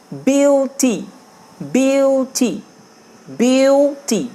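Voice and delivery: Beauty stretched slowly, syllable by syllable. Shown as a demonstration. Teacher shows children how to read slowly. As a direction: draw out beauty slowly for the class. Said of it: "ভেঙে ভেঙে পড়ি".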